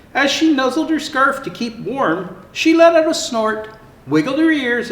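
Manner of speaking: lively storybook narration; reading to children. Acted. Santa reading a zoo story to children, lively. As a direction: tell it brightly for little ones. Reads Fiona story aloud.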